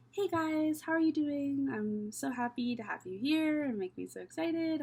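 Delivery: workplace voice. Shown as a demonstration. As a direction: put on the polite work persona. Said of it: "when I'm not in that voice".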